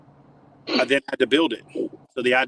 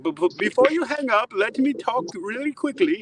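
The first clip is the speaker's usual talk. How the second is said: put-on high, hurried German-accented plea; pleading German caller; 12 semitones higher than usual. Owner pleads before Chad hangs up, German accent. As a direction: beg him not to hang up.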